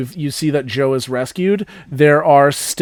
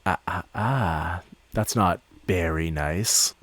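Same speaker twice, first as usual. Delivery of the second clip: low, slow, soft scolding; hushed, deep-pitched menacing tease; taunting villain; 8 semitones lower, 9 dB quieter, slower than usual. Acted. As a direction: tut at them slowly in a low, quiet voice. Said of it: "and he goes".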